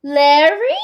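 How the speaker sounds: high, loud theatrical diva; grand diva. A narrator's reading. Film star calling out to the crowd, theatrical diva voice. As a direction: sweep in like a stage star. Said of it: "she called out suddenly".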